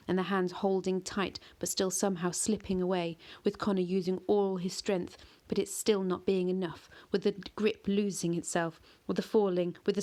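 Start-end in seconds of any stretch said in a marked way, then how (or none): none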